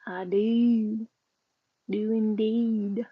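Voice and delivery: slow, drawn-out Southern drawl on indeed; playful Southern accent, stretching each word; hamming up a Southern twang. Pretend. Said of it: "I often flow into a kind of a southern accent".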